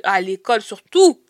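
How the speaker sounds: usual speaking voice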